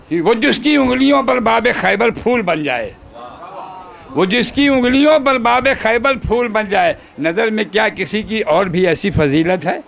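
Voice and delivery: measured, projected mushaira-style recital; poem recitation. Acted. Poet reciting praise verse to gathering, declaimed. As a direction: declaim the couplet, lingering on line endings.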